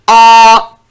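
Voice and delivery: loud mock scream of frightened friends; sudden hammed-up shriek; imitated scream. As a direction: let out one short loud "Ah!" like panicked kids. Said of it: "you hear friends screaming like this".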